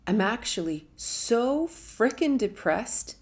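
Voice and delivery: emphatic, anguished admission; slow emphatic. Real, not acted. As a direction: lean hard on every word. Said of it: "emphasising depth of despair".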